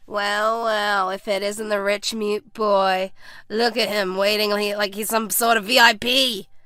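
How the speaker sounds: slow, drawn-out taunting sarcasm; sarcastic. A narrator's reading. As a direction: jeer at him like a playground bully. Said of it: "sarcastically said".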